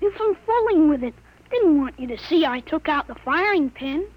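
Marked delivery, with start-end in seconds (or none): none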